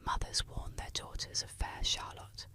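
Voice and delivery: plain reading voice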